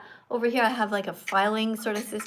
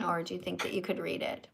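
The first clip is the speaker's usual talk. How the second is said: normal talking voice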